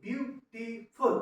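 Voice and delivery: "Beautiful" slowly split into three beats; syllable-by-syllable demo. Shown as a demonstration. Teacher counting syllables for students, "beautiful" slow in three beats. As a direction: slowly separate view, टी, फुल in "beautiful". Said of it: "कितने लफ्जों पे पड़ रहा".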